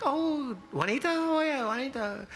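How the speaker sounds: very high-pitched singsong recognition; motel maids. Retold or imitated.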